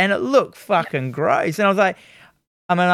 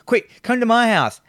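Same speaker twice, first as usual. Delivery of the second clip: higher, hurried mock plea; urgent girl; 7 semitones higher than usual. The girl hurries the artist off the street in a high mock voice. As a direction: rush him indoors like a worried stranger. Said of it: "he's just like".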